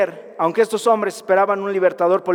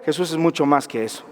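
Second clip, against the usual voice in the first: usual speaking voice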